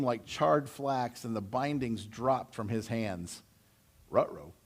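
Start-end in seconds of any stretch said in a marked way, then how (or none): 4.1-4.5 low cartoon-dog uh-oh. Preacher jokes as the ropes fall, low cartoon-dog uh-oh.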